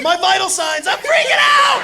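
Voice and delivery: high shouting panic; freaking out, voice cracking up; panicked. Retold or imitated. Brother at blood pressure machine, panicked shout.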